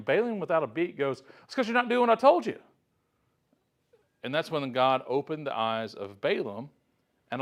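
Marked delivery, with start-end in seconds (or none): 1.4-2.6 higher, fast exasperated snap; frustrated outburst. Retold or imitated. Balaam snaps at his donkey, exasperated and fast. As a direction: blurt it out without a beat, fed up. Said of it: "this is frustrating him".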